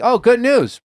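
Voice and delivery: high, bright good-news chirp; fake cheerful. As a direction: announce it with forced cheer. Retold employee, chirpy.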